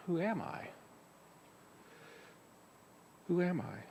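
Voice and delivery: hushed, reflective who am I; quiet inner question. Shown as a demonstration. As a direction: ask it silently inside. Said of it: "quietly and silently within yourself".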